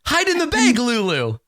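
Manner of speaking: high, loud mock coaxing call, with a laugh; playful calling out. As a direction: call out to lure someone over, hammed-up. Playfully calling Lulu into the bag.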